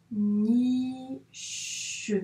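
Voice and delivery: "niche" stretched into two slow syllables; dictation-style "niche", long hushing ch. Shown as a demonstration. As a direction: draw out "niche" as ni, then che. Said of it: "on va écrire le ni, puis che".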